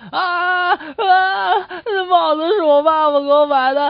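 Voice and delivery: put-on bawling child, high and loud; loud mock crying. Nephew crying for his lost hat, loud hammed-up bawling. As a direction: wail it like a hammed-up little boy. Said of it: "就大声哭了起来，边哭就边说".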